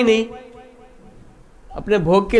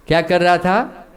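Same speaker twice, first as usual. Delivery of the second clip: ordinary talk, nothing marked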